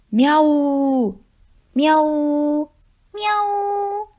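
high playful meows; mice meowing. Acted. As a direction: meow back like little cats. Mice meow back. (小老鼠们也学猫叫)

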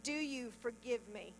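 soft, low, hushed question; voix de Dieu. Retold or imitated. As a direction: ask it gently and low, as God to a hurting child. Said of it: "he says this".

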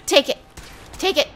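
loud high battle yell; excited shout. Real, not acted. Player yells at synths while shooting them. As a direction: shout it as you fire.

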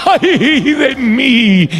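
very slow, high crying lament; anguished wail. Acted. Isaiah before the King, wailing in anguish. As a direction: draw out Ay de mí as a sob. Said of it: "Él dijo".

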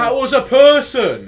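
high, loud, slow insistence; insistent shout. Real, not acted. Friend disputing a ghost sighting, insistent shout. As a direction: argue it back at full volume. Arguing over what appeared.

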